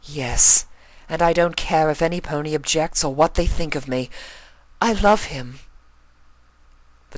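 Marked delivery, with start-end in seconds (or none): 0.0-5.6 higher and louder, rising to a passionate declaration; Rarity declaring love. Acted. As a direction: build to a swooning I love him.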